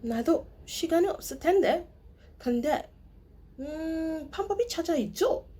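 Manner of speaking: high, very fast hurried lady; rushed driver. Retold or imitated. Busy driver rushing a caller on the phone. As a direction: rattle it off in a panic. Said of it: "she was like".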